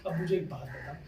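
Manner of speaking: ordinary talk, nothing marked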